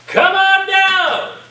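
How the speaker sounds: high, loud, slow game show callout; game show host. Acted. Professor calling the newcomer to the front like a game show host. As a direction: summon him like a TV host calling a contestant.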